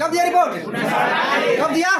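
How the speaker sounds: loud, sharp classroom question; teacher shouting for the answer; demanding shout. Real, not acted.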